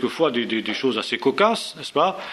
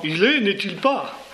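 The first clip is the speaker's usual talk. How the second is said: slow, much higher, with an odd put-on timbre; mock Breton speaking French; 7 semitones higher, slower than usual. Teacher voicing a Breton from Astérix for the class, slow and much higher. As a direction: play a Breton doing a word-for-word translation of English, slowly and much higher. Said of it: "Écoutons-les parler".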